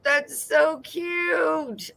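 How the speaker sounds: slow, high melting aww; sympathetic aww. Real, not acted. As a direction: coo it like seeing a puppy. Adoring the sticker on the board.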